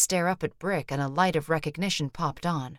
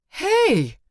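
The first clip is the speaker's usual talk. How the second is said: a much higher, much louder shout after him; calling out; 14 semitones higher, 9 dB louder than usual. A narrator's reading. Brick shouting for the man who vanished. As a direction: call loud down the empty hall.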